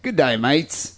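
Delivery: a light Aussie twang on Good day mates; mock Australian accent. Host imitating the caller's Aussie greeting, lightly accented. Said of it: "stereotypical, like, Australian accent".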